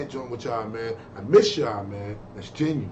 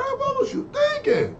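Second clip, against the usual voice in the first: much higher, incredulous teasing question; mock disbelief; slower than usual. Real, not acted. Host teasing the comedian in mock disbelief.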